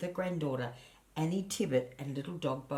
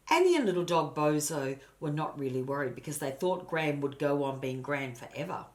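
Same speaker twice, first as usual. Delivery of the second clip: ordinary delivery, nothing marked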